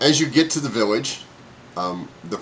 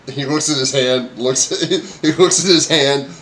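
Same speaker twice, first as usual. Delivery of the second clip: ordinary delivery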